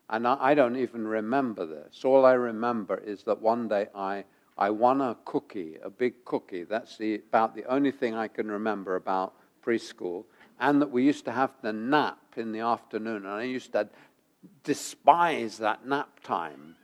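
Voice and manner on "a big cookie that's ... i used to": ordinary delivery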